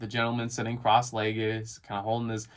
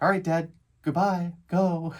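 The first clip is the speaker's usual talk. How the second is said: lightly voiced little boy, higher and louder; son's breezy goodbye; 5 semitones higher, 5 dB louder than usual. Dad mimics his son saying goodbye at drop-off, lightly voiced child. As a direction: imitate a kid brushing Dad off.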